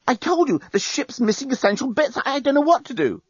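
high-pitched, flustered defence; panicked protest. Acted. Journalist telling the others the ship's bits are missing, panicked. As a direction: protest helplessly, pitch right up.

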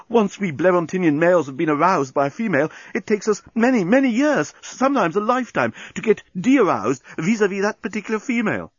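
high-pitched, agitated lament; moaning. A narrator's reading. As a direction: plead your case in a high moan. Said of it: "moaned the journalist".

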